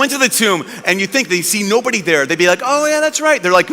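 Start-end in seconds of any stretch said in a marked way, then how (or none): 2.6-3.4 high, airy mock realisation; sing-out it like a forgotten errand; casual remembering. Disciples imagined finding the empty tomb, casually remembering.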